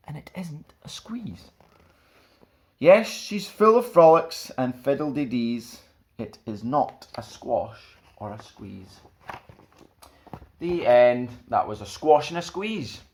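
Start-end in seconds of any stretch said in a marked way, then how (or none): 0.1-1.5 soft storybook whisper. A narrator's reading. Storyteller whispering the happy ending to children. 2.8-11.3 put-on lively read-aloud to children; children's story reading. A narrator's reading. Storyteller reading a picture book to children, lively and bright.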